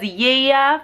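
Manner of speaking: Lilia said as "Yeah", both L sounds lost; toddler pronunciation. Retold or imitated. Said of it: "he doesn't say them exactly like that".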